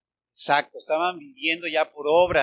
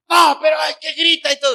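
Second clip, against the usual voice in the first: high shouted complaint; mock yell; 10 semitones higher, 11 dB louder, faster than usual. Preacher imitating a shouting boss, high and loud. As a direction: yell it like someone who can't stop shouting. Mocking a yelling person.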